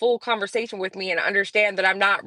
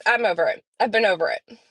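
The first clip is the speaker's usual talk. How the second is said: usual speaking voice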